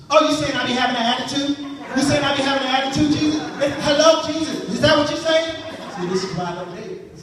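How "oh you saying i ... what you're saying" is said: mock offended girlfriend. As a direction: get in his face about it. Girl takes offense at Jesus.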